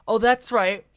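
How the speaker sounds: ordinary talk, nothing marked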